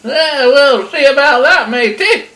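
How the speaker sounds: loud, high granny on the warpath; feisty old lady taking charge; granny's indignant threat. Acted. Granny to the wolf she caught, loud and threatening. Grandma thumps and confronts wolf.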